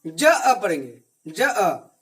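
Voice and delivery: teacher showing ज अ, not जा; short separate syllables. Shown as a demonstration. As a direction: say ज अ as two short separate sounds, no long जा. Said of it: "जा नहीं पढ़ेंगे".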